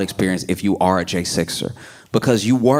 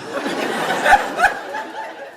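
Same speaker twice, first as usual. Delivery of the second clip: squealing airy laughter; high unvoiced cackle; high breathy laugh. Real, not acted. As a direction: let out a high wheezing laugh. Laughs after joke.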